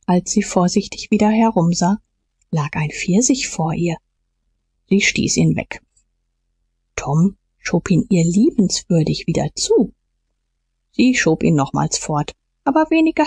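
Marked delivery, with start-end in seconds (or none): none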